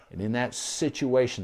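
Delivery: ordinary talk, nothing marked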